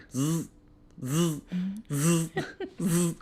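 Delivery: repeated mouth buzz of a faulty speaker; imitated buzzing. Dad mimicking the buzzing speaker in the rocket ship, a mouth buzz. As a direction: buzz like broken electronics, pulsing. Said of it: "one of the components is going".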